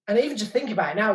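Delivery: ordinary delivery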